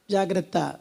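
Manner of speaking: a grave quiet caution; hushed warning. Acted. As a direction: drop low and soft to warn. Preacher warns the congregation. (తగ్గు స్వరంలో హెచ్చరిక)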